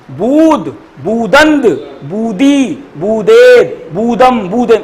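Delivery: each form set apart with a pause; word by word. Shown as a demonstration. Teacher modelling a verb table for students, word by word. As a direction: recite the forms one at a time, deliberately.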